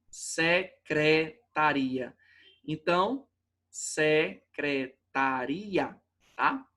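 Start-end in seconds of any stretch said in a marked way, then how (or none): 0.1-2.2 "Secretaria" very slowly: se - cre - ta-RI-a; syllable by syllable. Shown as a demonstration. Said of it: "A sílaba tônica tá no ri". 3.7-6.0 "secretaria" very slow and lower: se - cre - ta - RI-a; syllable by syllable. Shown as a demonstration. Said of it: "A sílaba tônica tá no ri".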